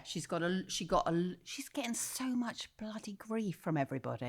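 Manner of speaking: a hushed, gossiping whisper. Real, not acted. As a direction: lean in and whisper the dirt. Gossiping aside about [name].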